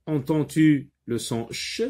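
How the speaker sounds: usual speaking voice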